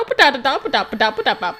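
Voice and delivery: ordinary delivery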